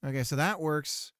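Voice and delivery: ordinary talk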